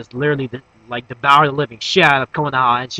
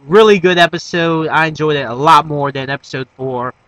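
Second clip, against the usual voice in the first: normal talking voice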